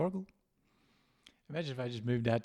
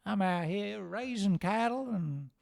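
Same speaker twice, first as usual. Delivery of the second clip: put-on Texan cowboy accent; 5 semitones higher than usual. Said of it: "I don't know what they do in Texas".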